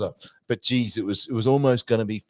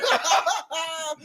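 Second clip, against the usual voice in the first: much higher amused giggle; a squealing laugh; high-pitched laugh. Retold or imitated. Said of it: "Did you hear me laughing".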